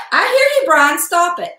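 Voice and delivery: high call telling the dog to stop. Real, not acted. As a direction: answer the whining dog from across the room. Calling back to the dog.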